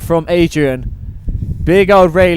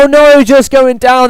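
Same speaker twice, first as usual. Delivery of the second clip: higher dismayed cry as he falls; surprised commentator; 7 semitones higher, 4 dB louder than usual. Real, not acted. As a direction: react to the fall. Rider falls mid run.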